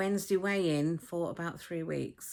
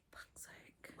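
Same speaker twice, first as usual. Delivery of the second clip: whispered curse; 24 dB quieter than usual. Retold or imitated. Retold nurse grumbling aside.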